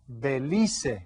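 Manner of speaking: Belize said as beh-LEE-seh; Spanish pronunciation. Shown as a demonstration. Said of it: "you pronounce all of the letters in Spanish".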